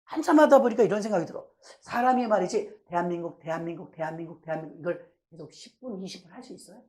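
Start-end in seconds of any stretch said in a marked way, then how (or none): 2.9-4.8 monotonous repetition. Shown as a demonstration. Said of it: "똑같은 말을 반복해서 계속".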